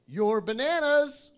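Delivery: slow, high, loud stretched send-off; weird bagger. Retold or imitated. Bagger reminding a customer about groceries, loud and weird.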